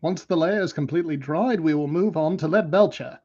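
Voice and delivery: lightly put-on English accent for a painting tutorial; Duncan Rhodes English accent. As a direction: do your best British hobby-painting presenter. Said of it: "That's my best Duncan Rhodes".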